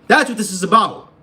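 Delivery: loud, high-pitched shouted repeat; emphatic shout. Real, not acted. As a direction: yell it to drive the point home. Repeats the same line as a shout.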